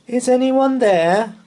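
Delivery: high, loud nervous call. Acted. Boy calls into dark hall, high and loud. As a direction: call into the gloom. Calling into the dim light.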